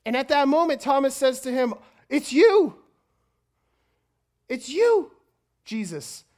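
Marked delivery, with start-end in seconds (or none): 2.1-6.2 high, loud burst of astonishment; amazed recognition. Acted. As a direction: exclaim it in joyful disbelief. Acts out Thomas recognizing Jesus.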